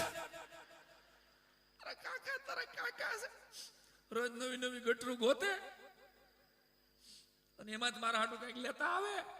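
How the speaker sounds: high-pitched hammed-up wail; keen loudly on the boasts, shrink to a thin sobbed refrain; mock weeping. Widowed aunt recalling her husband's drinking, mock weeping. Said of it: "રોતા રોતા કાકીએ કીધું".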